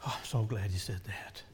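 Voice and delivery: breathy hushed aside; hushed whisper. Real, not acted.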